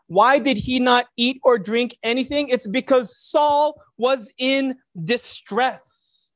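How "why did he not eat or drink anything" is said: ordinary talk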